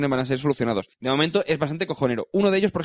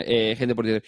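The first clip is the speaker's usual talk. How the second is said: ordinary talk, nothing marked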